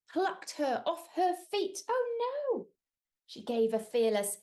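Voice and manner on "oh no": mock alarm. Giant plucks her up.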